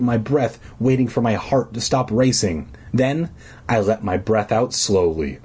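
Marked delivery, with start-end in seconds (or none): none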